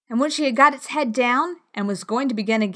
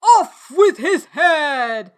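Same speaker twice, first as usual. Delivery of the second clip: slow roaring royal command; furious shout; 5 semitones higher, 8 dB louder, much slower than usual. A narrator's reading. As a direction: bellow it like a furious queen. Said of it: "went stamping about and shouting".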